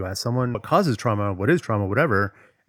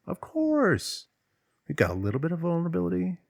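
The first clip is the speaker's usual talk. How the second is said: squeaky mock aside; high squeaky aside; 8 semitones higher than usual. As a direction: pitch jumps way up, quick and light.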